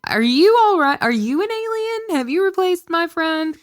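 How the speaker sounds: high-pitched comic suspicion; mock alarm. Asking a friend if an alien replaced them, mock alarm. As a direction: question them like a suspected impostor.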